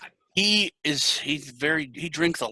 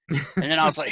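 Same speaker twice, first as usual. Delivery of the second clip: usual speaking voice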